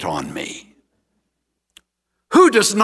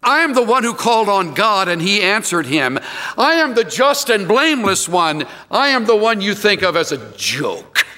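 wounded Job; 6 semitones higher than usual. Acted. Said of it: "I am a joke to my friends".

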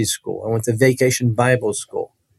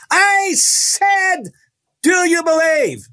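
demanding preacher; much slower than usual. Retold or imitated. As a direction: spin round and demand an answer. Preacher spins round, demands again.

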